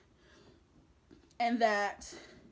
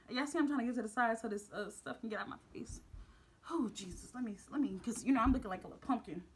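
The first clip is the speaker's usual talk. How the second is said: quiet aside; 7 dB quieter, faster than usual. Real, not acted. Aside while fixing hair.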